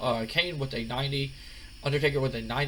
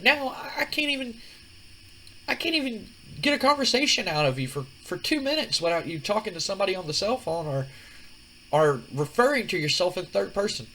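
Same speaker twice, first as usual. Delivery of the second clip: much higher, exasperated woman's voice; Stephanie, exasperated; 8 semitones higher than usual. Retold or imitated. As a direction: pour out the complaint, fed up. Stephanie complains to The Rock.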